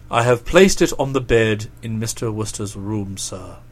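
very low, measured valet voice; low-pitched, discreet manservant; deferential valet. Acted. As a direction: report respectfully in a deep voice, dropping softly on "sir". Jeeves the valet answers.

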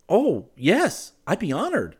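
loud, high burst of willingness; eager cry. A narrator's reading. Suitor accepts her request, crying eagerly. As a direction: cry out eagerly, pitch swooping. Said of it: "he cried".

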